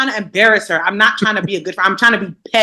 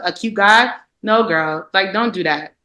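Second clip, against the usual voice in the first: normal talking voice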